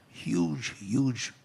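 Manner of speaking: Huge hit high and loud, then huge again almost as strong; emphatic repetition. Real, not acted. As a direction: hammer home Huge, huge. Stressing the size of dependency.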